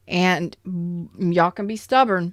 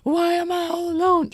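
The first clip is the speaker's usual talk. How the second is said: high wail; 10 semitones higher than usual. Retold or imitated. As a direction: wail it high like a lonely child. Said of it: "you're just like".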